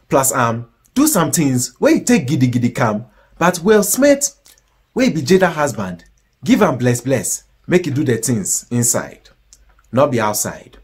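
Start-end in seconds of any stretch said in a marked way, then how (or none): none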